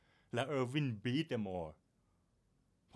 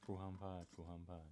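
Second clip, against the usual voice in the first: much softer, low muttered repeat; hushed echo; 4 semitones lower, 13 dB quieter than usual. Pretend. Barista echoing his friend's panic, hushed and low. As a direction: murmur it back to yourself under your breath.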